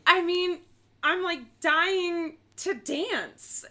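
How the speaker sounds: high, loud, stretched-out gush; hammed-up eager doll voice; mock gushing Barbie. Barbie gushing to the dancers, high and stretched. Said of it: "She's like".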